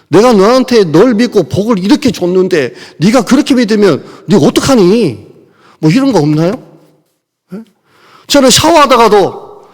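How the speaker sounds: loud, high, urgent sermon voice; fiery preaching. Acted. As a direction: drive the message home at full force. Preaching at full force.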